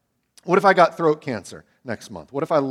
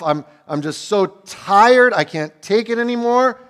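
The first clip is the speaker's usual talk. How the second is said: loud, higher, dragged-out despair; desperate outcry; 6 semitones higher, 5 dB louder than usual. Acted. David crying that he can't take any more, loud and desperate. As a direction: push it out at the breaking point.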